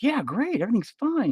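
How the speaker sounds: put-on high, bright kid voices; chipper kids. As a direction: pitch it way up like happy kids. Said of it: "they're like".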